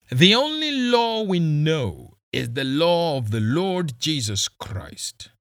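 high, loud gloating pronouncement; gloating police boss. Acted. Kisii gloating to the boy about killing them, high and loud. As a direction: proclaim it like a grim sermon.

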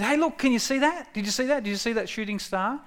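put-on high, eager calls to a child to look; a parent grabbing a kid's arm, pointing up; excited parent. Parent showing a child a shooting star, high and excited. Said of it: "There's almost a bit of fatherly excitement in that".

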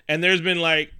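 usual speaking voice

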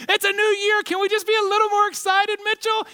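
high, loud, shouted mock plea; mock excited plea. Listener teasing the preacher to get excited, shouting. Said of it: "You might be saying".

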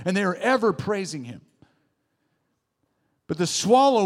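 preaching. Acted. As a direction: lift the room with the sermon. Preaching again, high and loud.

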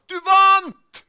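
explosive high-pitched shout of news; triumphant shout. Retold or imitated. Red-faced boy running up the stairs at the winner, shouting. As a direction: yell it at full volume, very high. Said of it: "Og så roper han".

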